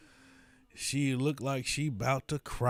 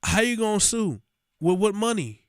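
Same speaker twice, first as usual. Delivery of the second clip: higher, louder mocking challenge; 7 semitones higher, 4 dB louder than usual. Real, not acted. Talking back at the supervisor on screen, loud and mocking. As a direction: scoff at the threat out loud.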